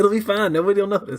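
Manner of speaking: high, loud breezy alien reassurance; careless alien. Retold or imitated. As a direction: wave it away cheerfully. Continues alien voice.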